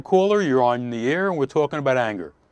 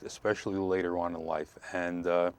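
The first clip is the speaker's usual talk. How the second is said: ordinary talk, nothing marked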